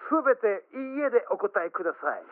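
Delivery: loud, high, broken-Japanese announcement; mock foreign learner's broken Japanese; broken foreigner's Japanese. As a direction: proclaim it like a foreigner parroting memorised Japanese. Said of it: "なんか変な片言言った".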